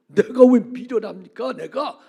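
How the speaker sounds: loud, slow, offended comeback; indignant protest. Retold or imitated. Pastor talking back to God, loud and offended. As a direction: throw the question back hard. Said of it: "막 또 따졌어요".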